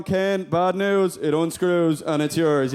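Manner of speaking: slow mock bad news. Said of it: "Barbie walking in and going".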